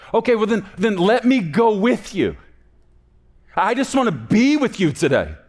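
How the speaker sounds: put-on high, loud, eager child bargaining; pleading child. Child asking to go to work with a parent, pleading. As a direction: beg like a kid wanting to tag along. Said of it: "And they say".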